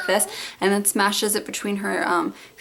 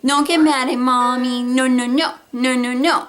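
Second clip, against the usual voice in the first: put-on mildly singled-out parent-to-baby voice; mock scolding; 3 semitones higher, 4 dB louder than usual. Mother imitating how she answers her whining baby, mild scolding. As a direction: lightly act out a mother chiding her baby. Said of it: "I always go".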